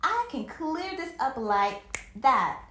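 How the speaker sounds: loud know-it-all retort; loud, superior comeback; snapped. A narrator's reading. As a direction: snap it back loudly. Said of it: "Mel snapped".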